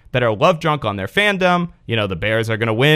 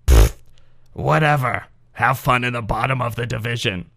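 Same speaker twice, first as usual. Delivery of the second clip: gravelly cartoon voice; 2 semitones lower than usual. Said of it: "gravelly cartoon character".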